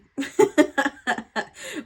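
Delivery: high burst of laughter; laughing. Real, not acted. Reader amused by her own therapist joke, laughing.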